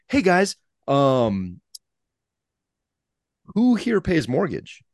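high, slow sarcastic parent question; mock indignant. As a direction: call the kids out, sarcastic. Parent scolding kids over bathrooms.

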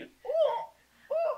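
pained ooh. Retold or imitated. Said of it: "I was like".

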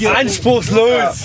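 gedehnt in die Runde gerufen; lauter Zwischenruf. Real, not acted. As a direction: das Urteil langgezogen dazwischenrufen. Neckender Zwischenruf beim Urteil. (lautes, gedehntes Rufen)

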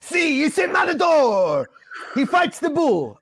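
hammed-up Spanish announcer with a laugh; mock Spanish shout.

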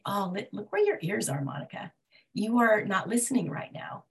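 soft whisper to herself; whispered self-talk. Retold or imitated. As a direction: hush it, as if scolding yourself under your breath. Self-talk, scolding herself inwardly.

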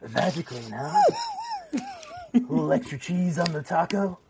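a lightly voiced, cocky Hank; Hank's voice. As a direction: toss it off as a smug tough guy, only a hint of a put-on voice. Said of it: "I have to do it in my best way to have it done in his, uh, voice".

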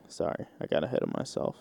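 hushed, quick, whispered apology; meek apology. Acted. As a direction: shrink back and whisper it quickly. Meek apology in acted mobster scene.

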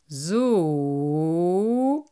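long, stretched doubtful "So"; unconvinced carp dragging the word; drawn out. Acted.

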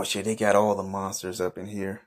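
normal talking voice

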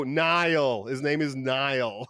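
NIALL drawn out slowly and clearly; translated pronunciation. Retold or imitated. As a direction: translate for the listeners, each NIALL stretched in full. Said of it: "I would translate for him".

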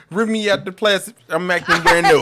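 high, loud quote through laughter; playful laughing quote. Acted. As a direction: belt out the catchphrase, laughing.

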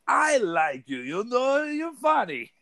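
high, playful imitation of Ray's Lithuanian accent. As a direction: mimic the Lithuanian coworker fondly. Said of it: "and he's like".

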